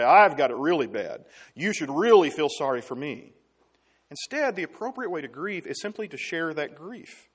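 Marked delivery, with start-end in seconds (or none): 0.0-3.3 a hint of a self-pitying griever, louder; self-pitying whine. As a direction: ask for pity. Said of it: "compare your situation ... and say".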